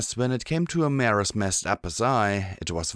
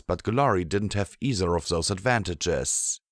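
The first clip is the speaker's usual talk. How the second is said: usual speaking voice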